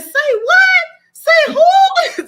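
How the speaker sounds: put-on high, loud shocked cry of an old aunt; aunt's shocked shriek. Aunt hearing of a grandchild's pregnancy, shrieking in shock. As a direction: shriek it out in disbelief. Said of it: "She said".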